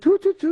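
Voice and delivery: high, loud imitated whistle toot toot; train whistle imitation. Survivor imitating the train whistle for the interviewer, high and loud.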